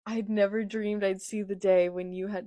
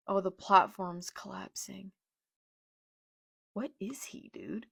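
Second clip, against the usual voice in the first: whisper. Real, not acted. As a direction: whisper it in disbelief. Hushed reaction while reading.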